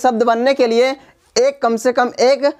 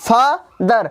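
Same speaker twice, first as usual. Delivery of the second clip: "father" slowly, as two separate beats, fa-ther; teacher sounding out "father" syllable by syllable; split into syllables. Shown as a demonstration. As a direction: break "father" after the first syllable. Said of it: "जितना भाग एक बार में बोला जाता है".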